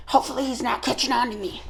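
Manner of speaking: hushed aside. Acted. Mermaid confiding she took the keys. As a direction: drop to a worried murmur.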